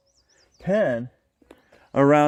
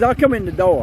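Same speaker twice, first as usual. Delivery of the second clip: high, loud voice for the goat; voicing the goat; 7 semitones higher, 7 dB louder than usual. Woman voices the goat's thoughts in a high childish voice. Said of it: "He says".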